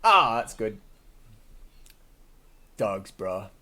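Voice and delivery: normal talking voice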